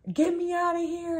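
high mock scream for help; panicked dream scream, voiced high; retold scream. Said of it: "And I'm, like, screaming".

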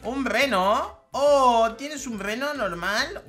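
high, drawn-out mock amazement; asombro burlón. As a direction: feign being hugely impressed. Se burla de la mascota rival. (asombro fingido, agudo y burlón)

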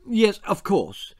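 a flustered politician caught off guard; flustered Prime Minister. Acted. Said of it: "said the Prime Minister".

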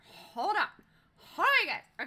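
high, loud interjection to the chat; calling for a pause. Real, not acted. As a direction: cut in loudly and high to halt everyone. Breaks off story to halt chat.

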